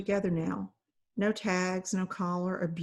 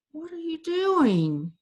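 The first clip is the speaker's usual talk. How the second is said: high, slow growl at the dog; 9 semitones higher, 3 dB louder, slower than usual. A narrator's reading. Fairy whirls on the dog following her, growling. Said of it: "she growled".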